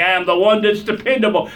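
ordinary talk, nothing marked

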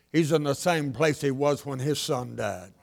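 soft, slow gentle answer; kindly pastor. Retold or imitated. Pastor gently answering a grieving mother, soft and slow. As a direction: say it softly and slowly, like a wise pastor.

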